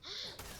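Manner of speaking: sharp gasp of realisation; quick, startled intake of breath; sudden gasp. Acted. Player realising Clément was not a friend, startled gasp.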